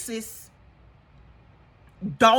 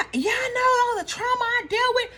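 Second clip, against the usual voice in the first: mock tearful Quad; 9 semitones higher than usual. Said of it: "Quad is just spewing".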